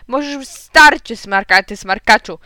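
slow, loud telling-off; angry shouting. A narrator's reading. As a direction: lay into the caller, word by word. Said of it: "krzyknął tata". (powolny, gniewny krzyk)

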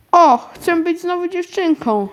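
ordinary delivery

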